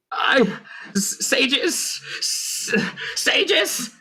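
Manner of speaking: high, stammering panic into a stone; panicked stammer. Acted. Villain stammering into his speech stone, panicked. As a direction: stutter the name in a frightened rush.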